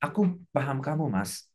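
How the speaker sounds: lightly voiced understanding wife; a hint of a tender spouse; spouse to husband. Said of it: "Bicara sama pasangan".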